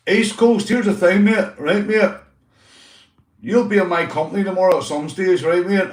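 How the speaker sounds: loud, high call to the mate. Real, not acted. Vlogger calling out to East Coast, loud and high. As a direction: holler over to the neighbour.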